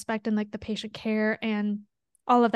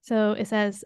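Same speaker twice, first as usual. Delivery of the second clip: usual speaking voice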